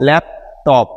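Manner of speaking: "Laptop" in two beats, a pause between lap and টপ; syllable by syllable. Shown as a demonstration. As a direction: stop after lap, then start afresh on টপ. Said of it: "নতুন করে আবার বলতে". ("Laptop" শব্দটি ল্যাপ, টপ দুই ভাগে থেমে)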